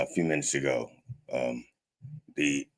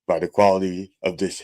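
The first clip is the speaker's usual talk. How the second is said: normal talking voice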